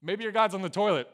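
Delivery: loud, high mock suggestion; joking taunt. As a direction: tease them with cheeky mockery. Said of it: "[name]'s making a joke of".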